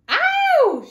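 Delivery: sudden high loud yelp; girl screaming when the wolf grabs her. Acted. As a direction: scream in pain as she's grabbed. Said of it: "she screamed".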